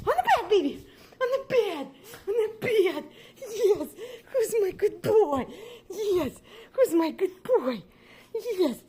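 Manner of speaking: very high cooing pet voice. Real, not acted. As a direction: coo up high like talking to a puppy. Owner cooing to her dog.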